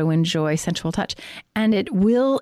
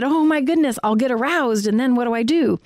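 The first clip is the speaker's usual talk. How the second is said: mock worried couple; 5 semitones higher than usual. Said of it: "there are worries that".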